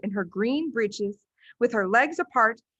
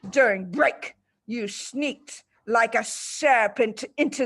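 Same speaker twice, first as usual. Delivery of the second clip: higher, louder indignant tirade; accusing headmistress; 3 semitones higher, 3 dB louder than usual. Acted. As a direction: rage over the stolen cake.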